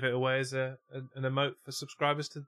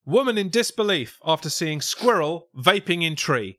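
louder, higher headline announcement; news headline read; 7 semitones higher, 9 dB louder than usual. Acted. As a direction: belt out the silly headline like a newsreader.

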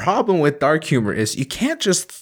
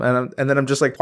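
ordinary talk, nothing marked

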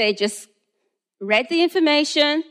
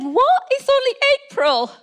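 much higher, incredulous "What? It's only April"; outraged at a December date; retold high-pitched indignation; indignant disbelief; 11 semitones higher than usual. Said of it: "I said".

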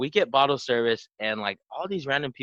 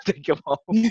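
laughing; 8 semitones higher, 3 dB louder, slower than usual. Real, not acted. Laughs while quoting the cops.

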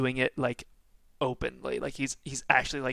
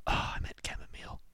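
muttered aside; 6 dB quieter, faster than usual. Acted. NPC muttering correction.

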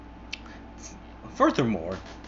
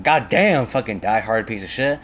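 exclaimed reaction; 7 dB louder than usual. Retold or imitated. Viewer reacting to the hero's cold answer, loud exclamation. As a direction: blurt it out loudly. Own reaction to the retold scene.

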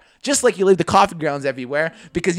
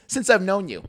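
ordinary talk, nothing marked

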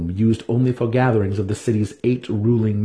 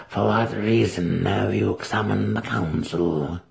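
put-on hushed, breathy old crone; a withered ruler's cold greeting; ancient matron; 4 dB quieter, slower than usual. Matron Banray opens the council, a hushed ancient voice.

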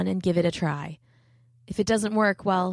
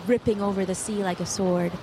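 ordinary delivery, nothing marked

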